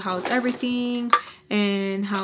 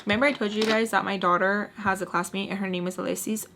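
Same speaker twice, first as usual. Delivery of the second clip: usual speaking voice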